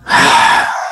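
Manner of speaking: big breathy sigh; loud heavy exhale. Acted. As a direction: blow out a long, loud breath. Character exhales in exasperation.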